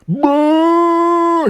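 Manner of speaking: high, loud hammed-up bark of Bah; mock the hunter's silly call to the bear; imitated yell. Reviewer imitating the hunter calling out the bear in a high hammed-up yell. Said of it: "He just yells out all loud".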